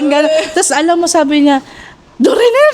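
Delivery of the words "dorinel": high called name; mother calling. Retold or imitated. Mother calling her daughter's name, a high call. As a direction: call out Dorinel like mom on the phone. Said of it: "tumawag siya".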